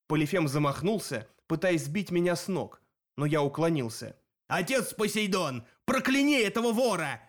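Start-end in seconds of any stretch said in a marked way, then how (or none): 4.5-7.2 cyclops invoking a curse. A narrator's reading. Cyclops calls curse from his father.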